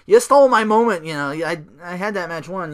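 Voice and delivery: high, loud goofy victory squeal; mock Bryan cheer. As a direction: gush like a giddy winner. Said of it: "so he's like".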